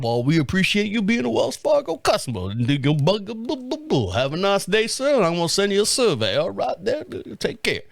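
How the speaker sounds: hammed-up Southern sign-off, garbled midway; exaggerated Southern customer-service voice; Southern gentleman. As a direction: gush politely in a Southern accent. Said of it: "he sounds like this Southern gentleman now".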